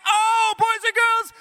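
high, loud hammed-up amazement; overwhelmed excitement. Leader overwhelmed by love before boys and girls, high shout. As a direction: holler it as if bowled over.

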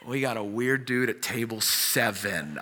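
low, slow, hushed report; hushed aside. Acted. Restaurant worker flags an odd customer to colleagues, hushed aside. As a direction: drop it low and discreet, as if alerting staff.

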